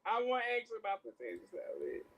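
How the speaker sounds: high, tearful whining complaint; hammed-up fake crying; mock upset. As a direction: wail it like a melodramatic tantrum. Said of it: "I'm so upset".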